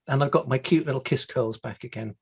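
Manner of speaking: usual speaking voice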